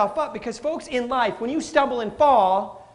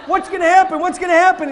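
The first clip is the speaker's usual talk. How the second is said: anxious excitement; 8 semitones higher, 7 dB louder, faster than usual. Retold or imitated. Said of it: "I'm like".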